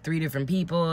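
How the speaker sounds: usual speaking voice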